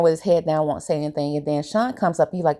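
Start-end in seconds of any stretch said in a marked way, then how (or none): none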